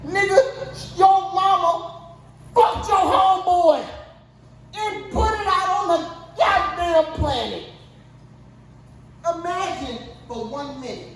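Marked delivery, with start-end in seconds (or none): 0.1-7.6 indignant rant. Real, not acted. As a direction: call it out in comic outrage. Ranting to Jaden about mother.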